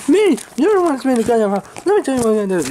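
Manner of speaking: high, indignant comeback; indignant protest. Acted. Man accused of being the problem, indignant. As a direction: protest hotly, pitched well up. Acted quarrel over being thrown out.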